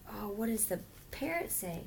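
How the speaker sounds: normal talking voice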